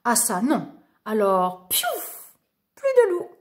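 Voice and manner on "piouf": very high playful whoosh; whoosh sound. A narrator's reading. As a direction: make him vanish with a squeaky pop. Magic vanishing sound.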